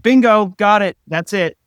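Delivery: loud, high bingo call; mock triumphant shout. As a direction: yell it like winning bingo. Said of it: "I'm like".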